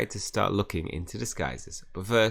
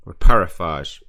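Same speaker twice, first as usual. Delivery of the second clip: loud spell cast; 12 dB louder than usual. Acted. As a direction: spell called out loud and firm. Casting spell, then recast.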